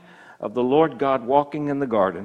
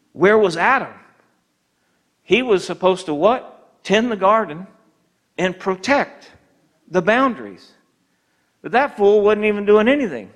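rising, louder sermon push; preaching hard; 4 semitones higher, 3 dB louder than usual. Acted. Pastor rebukes a passive Adam, preaching hard. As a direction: preach it out to the congregation.

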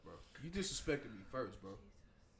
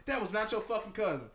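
heated; 6 semitones higher, 7 dB louder than usual. Retold or imitated. Girlfriend's heated retort retold.

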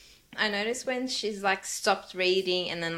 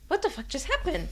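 baffled reaction; 7 semitones higher, faster than usual. Retold or imitated. Acting out confused listener reaction.

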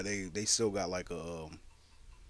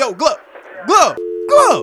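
shouting after him, much higher and louder; calling out; 13 semitones higher, 15 dB louder, slower than usual. Acted. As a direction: yell his name as he leaves. Calling after hanging-up caller.

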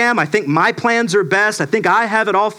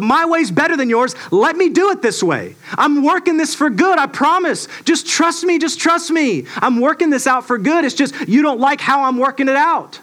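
voicing God, reassuring; 6 semitones higher than usual. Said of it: "And he goes".

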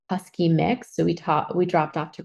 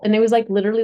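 usual speaking voice

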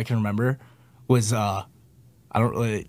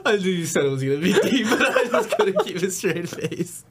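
slow words through high giggles; stifled giggles; 8 semitones higher, slower than usual. Real, not acted. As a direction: try to hold back laughter. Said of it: "Sorry, I laughed".

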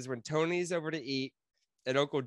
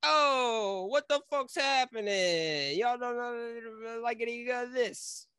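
Italian accent; 9 semitones higher, much slower than usual. Shown as a demonstration. A second audition try in a slow, high Italian accent. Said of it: "let me try out this one instead".

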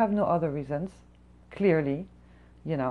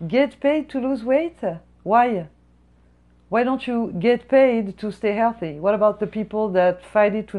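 slow, louder and higher disbelief; incredulous; 4 semitones higher, 8 dB louder than usual. Real, not acted. Server rants about a weight-loss program, incredulous. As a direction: stretch it out in outrage.

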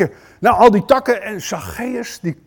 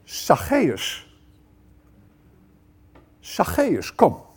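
tender call; 4 dB quieter, much slower than usual. Pretend. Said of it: "Maar wat zegt Jezus?".

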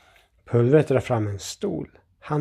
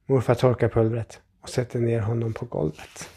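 usual speaking voice

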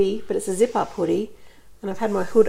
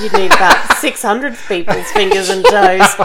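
laughing; 5 semitones higher, 6 dB louder than usual. Real, not acted. Teasing friend about dating.

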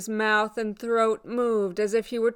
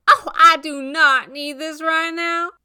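high, loud annoyed protest; raised, irritated voice; exasperated; 8 semitones higher, 6 dB louder than usual. Acted. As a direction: push back, fed up with an unwanted visitor. Rose rebuffs unwelcome ex, acted.

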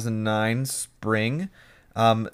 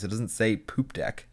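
ordinary talk

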